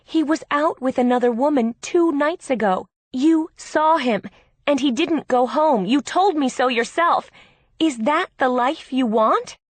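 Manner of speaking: heated, emphatic pleading; exasperated argument. A narrator's reading. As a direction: confront your sister forcefully with the facts. Sister argues with cheated-on bride.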